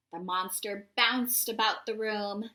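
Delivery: ordinary delivery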